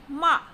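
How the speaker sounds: "ma" stressed, falling sharply; fourth tone. Shown as a demonstration. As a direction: drop "ma" like a command. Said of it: "with fourth tone".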